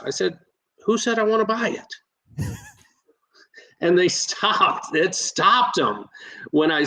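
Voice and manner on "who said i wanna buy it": quick, higher-pitched retort; retold excited comeback. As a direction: fire the question back, matching his tone. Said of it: "I'm more excited sounding and such".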